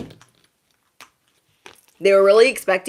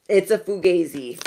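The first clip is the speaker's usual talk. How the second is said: usual speaking voice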